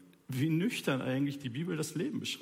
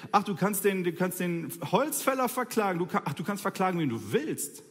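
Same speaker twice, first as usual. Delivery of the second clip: ordinary delivery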